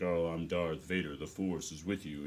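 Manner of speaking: just push the voice way down to play Vader; mock Darth Vader. Shown as a demonstration. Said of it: "it does not matter how low you could get your voice".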